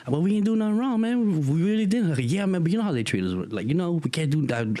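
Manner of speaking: high-pitched acted protest; arguing. Kids in trouble debating how they are treated, high-pitched arguing. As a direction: argue back urgently, pitch pushed up. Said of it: "Now we're arguing".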